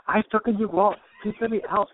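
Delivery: high-pitched mock Indian accent. Trapped woman begging through the wall, comic Indian accent. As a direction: plead in a put-on Indian accent. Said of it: "I feel like I'm from India now".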